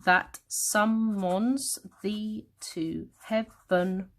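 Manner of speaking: very slow, syllable by syllable; counting syllables. Shown as a demonstration. Teacher counting the line to ten, very slow syllables. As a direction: stretch and separate every syllable of the verse. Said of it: "Yeah, they're ten".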